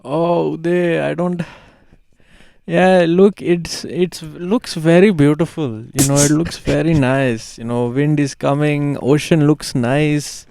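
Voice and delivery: put-on grandma in a light Indian accent. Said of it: "Do you wanna do it in her accent?".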